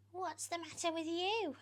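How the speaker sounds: put-on high, loud, shrill screech at the boy; very high screeching hag; screeching Aunt Spiker. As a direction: screech at James like a nasty aunt. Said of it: "Aunt Spiker screeched".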